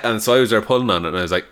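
ordinary talk

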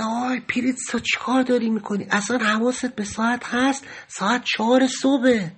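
softly voiced, retold remonstrance; hushed protest. Cellmate shushing Pritsa at four in the morning, hushed protest. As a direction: keep it down, as if others are sleeping.

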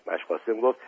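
ordinary delivery, nothing marked

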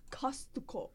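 put-on "Costco" as Kosutoko, Japanese-accented. Teacher repeats Costco for puzzled locals, Japanese accent. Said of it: "say it in a Japanese accent".

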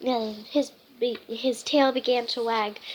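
plain reading voice

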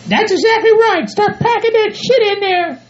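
high falsetto scolding voice; bossy partner. Acted. Bride telling groom to bury the manure, bossy. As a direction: lay down the law in a high put-on voice.

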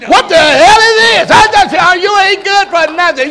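high, loud outraged husband; angry husband. Acted. Husband arriving to scold a good-for-nothing, angry shouting. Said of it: "Husband come".

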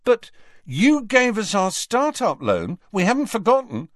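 high, emphatic, stern reminder. A narrator's reading. George reminds Harry of the start-up loan, stern. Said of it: "said George sternly".

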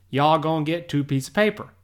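quick, run-together "Y'all gonna get two piece of paper"; casual Southern pronunciation. Retold or imitated. As if talking to a Southern cousin, casual Southern pronunciation. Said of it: "she is from the southeastern part".

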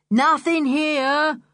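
a mild call to mother. Acted. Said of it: "he called to his mother".

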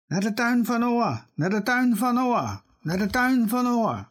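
very high, chanted call repeated thrice; bird's call. Acted. The woodpecker calls the forest animals to Noah's garden. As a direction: sing it out like a bird spreading news.

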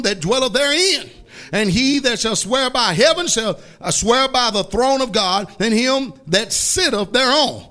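forceful, measured scripture declamation; scripture reading. Retold or imitated. Preacher reading Jesus's woes to church, weighty declamation. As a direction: roll through the verse, then land each word separately.

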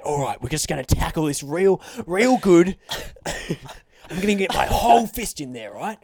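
eager Australian wildlife presenter; Steve Irwin. Acted. Doctor tackling a prostate check, enthusiastic Australian voice. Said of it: "Imagine if Steve Irwin was your doctor".

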